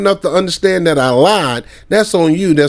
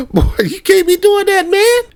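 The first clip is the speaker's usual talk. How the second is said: much higher, breaking into laughter; laughing disbelief. Real, not acted. Scolding a friend over a prank, high laughing protest. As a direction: scold him through real laughter.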